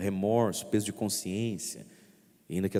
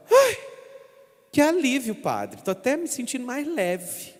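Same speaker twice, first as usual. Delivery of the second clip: much higher, sighing relief; relieved penitent; 3 dB louder than usual. Retold or imitated. Priest imitating a penitent leaving confession, relieved. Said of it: "sai e fala".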